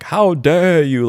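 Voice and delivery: slow, hammed-up "How dare you" lecture; a light mocking take on her scolding; mock Greta Thunberg. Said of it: "like Greta Thunberg".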